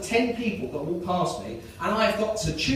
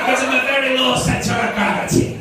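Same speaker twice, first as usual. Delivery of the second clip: louder, higher Scottish sports analyst; attempted Scottish accent; 4 semitones higher, 6 dB louder than usual. Scottish analyst commenting on the runner's weight, loud mock-Scottish accent. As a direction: push the hammed-up Scottish commentary voice. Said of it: "Imagine he sounds Scottish".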